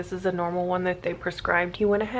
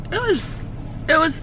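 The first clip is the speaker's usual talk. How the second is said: fighting back tears mid-sentence; voice breaking; 9 semitones higher, 3 dB louder than usual. Real, not acted. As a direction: voice cracking high, about to cry. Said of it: "Sorry about all the crying earlier".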